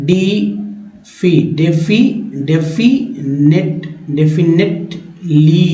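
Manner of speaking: slow, adding one syllable at a time; building up syllables. Shown as a demonstration. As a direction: build the word up piece by piece. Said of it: "definitely word है". (शब्द को टुकड़ों में जोड़ते हुए)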